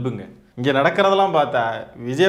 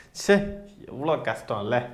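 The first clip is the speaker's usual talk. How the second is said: hushed, much softer fake sympathy; mock pity; 5 semitones lower, 7 dB quieter than usual. Taunting the star's fans, soft mock sympathy. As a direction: sigh it out with sarcastic pity.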